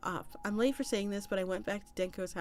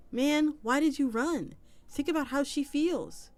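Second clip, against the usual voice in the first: exasperated scolding; 7 semitones higher, 4 dB louder than usual. Acted. Another reply scolding OP.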